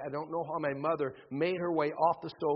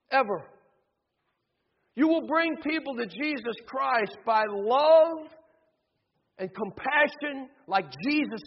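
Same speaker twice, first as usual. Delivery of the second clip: fervent preacher, higher and louder; preaching; 4 semitones higher, 3 dB louder than usual. Acted. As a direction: drive the message home to the congregation. Preacher applies story to congregation.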